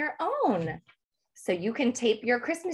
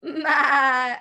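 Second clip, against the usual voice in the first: goat bleat; 3 semitones higher, 5 dB louder, much slower than usual. Pretend. Goat bleat praised to children.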